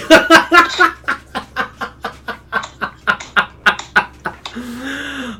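long high burst of laughter; big laugh. Real, not acted. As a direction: break into helpless laughter. Cracking up at own joke.

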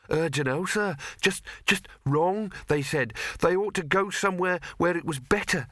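high, whining servant voice; nasal whine. Acted. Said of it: "he whined".

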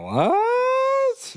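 very high, stretched squeal; shocked disbelief. Real, not acted. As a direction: shoot the voice way up in incredulity. Reacts to the shock collar story.

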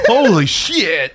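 loud, slow, high exclamation with a laugh; drawn-out laughing outburst; amused shock. Real, not acted. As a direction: laugh in disbelief at a rude joke. Reacts to host insulting the fans.

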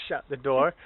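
usual speaking voice

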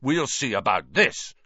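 indignant and determined; outraged. A narrator's reading. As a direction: protest it with contained outrage. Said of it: "Wood hissed in outrage".

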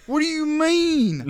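high, slow, loud disbelief; incredulous. Retold or imitated. Narrator asks the pill-counting driver, high and incredulous. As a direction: stretch it out in shock.

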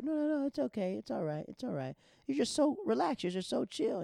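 very high, loud nervous flattery; gushing backpedal. Retold or imitated. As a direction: suck up fast and high.

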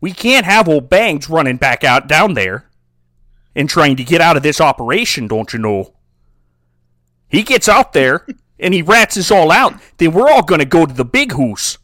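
put-on loud, hammy Canadian accent; Canadian mobster. Mobster warning cousin Harry about Bangs, in a Canadian accent. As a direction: lay down orders in exaggerated Canadian. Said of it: "This here is a good Canadian accent".

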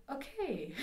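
high, drawn-out uneasy okay; awkward okay. Retold or imitated. Business lady answering the rude kid, imitated awkwardly.

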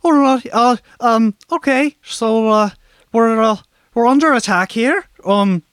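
high, loud flustered voice; panicked Tedby voice. Acted. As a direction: babble in a nervous panic.

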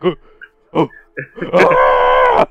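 two short grunts, then a high scream; high drawn-out mock shriek. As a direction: build to a loud, high comic scream. Acted-out noise, then both laugh.

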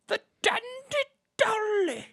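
very high, showy voice; trying out accents. Shown as a demonstration. Host testing accents for the name, high-pitched voice. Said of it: "accent to say the Dandy Dolly with".

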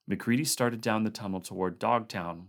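plain reading voice

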